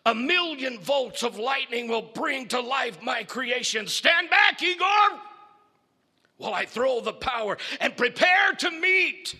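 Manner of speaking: high, loud triumphant shout; shouting mad doctor. Acted. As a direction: roar it over the machinery. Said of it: "the doctor shouts".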